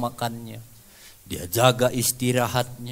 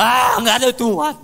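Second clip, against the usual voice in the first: bitter dismissal; 12 semitones higher, 10 dB louder than usual. Retold or imitated. Sick man rejects visiting friends' comfort.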